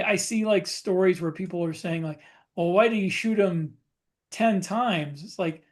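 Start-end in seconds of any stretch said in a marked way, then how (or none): none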